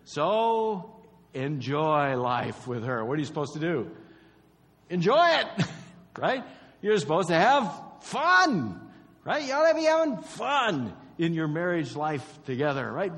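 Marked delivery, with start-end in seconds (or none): 0.0-3.0 slow, louder, said with the congregation; congregational recitation. Shown as a demonstration. Preacher leading the congregation to recite it slowly. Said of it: "Say it with me". 4.9-13.2 much louder and higher, exhorting; fervent preaching. Real, not acted. Preacher exhorting couples to have fun, loud and fervent.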